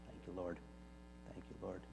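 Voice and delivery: very soft, low murmured thanks; hushed prayer. Real, not acted. Preacher thanking the Lord after reading, hushed. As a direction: pray it under your breath.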